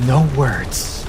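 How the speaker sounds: normal talking voice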